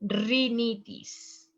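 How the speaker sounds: rhinitis said slowly, "ri-NEE-tis"; slow Spanish pronunciation. Shown as a demonstration. Teacher slowly models rhinitis for the class. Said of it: "Remember the letter E in Spanish".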